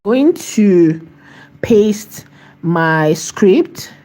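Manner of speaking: slow, drawn-out words as she types; dictating while typing. Real, not acted. To the AI she types to, slow dictation. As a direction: spell it out slowly for the typing. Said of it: "I'm typing".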